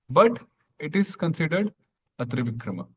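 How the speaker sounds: ordinary delivery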